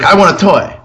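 imitated child, high voice; demanding child. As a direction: mimic a kid's high-pitched demand. Retold child begging for toy.

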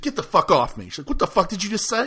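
put-on offended girl turning on him; mock outraged. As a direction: snap back in shock, half-acted.

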